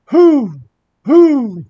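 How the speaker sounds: two loud, high owl hoots; high, drawn-out "hoo" calls. Acted. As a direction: hoot like an owl from the rafters. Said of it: "As if on cue came the".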